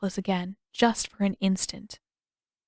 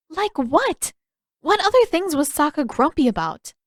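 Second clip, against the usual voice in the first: higher, pressing question; curious Katara; 8 semitones higher, 5 dB louder than usual. Acted. Katara pressing Aang about Sokka, higher pitch. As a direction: probe for more.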